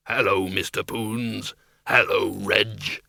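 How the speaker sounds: low, slow creature voice; deep, unhurried monster hello; bogeyman. A narrator's reading. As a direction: greet them slowly as a big hairy bogeyman. Bogeyman Schleppel greets his friends.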